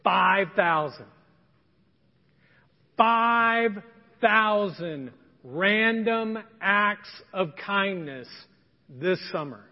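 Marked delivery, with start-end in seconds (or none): 0.0-1.1 "Five thousand" slow and louder, each word weighted; emphatic goal. Real, not acted. Pastor announces the church's goal to the congregation, slow and emphatic. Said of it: "This is the goal". 3.0-8.3 "Five thousand random acts of kindness" very slow and louder, word by word; drawn-out emphasis. Real, not acted. Pastor challenges everyone to the summer goal, very slow and emphatic. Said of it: "This is the goal".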